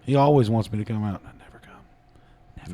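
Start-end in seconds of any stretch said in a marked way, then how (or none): 1.2-2.7 breathy secret whisper; mock-secret whispered confession. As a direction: confide it slowly under breath. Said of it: "whispered aside joke".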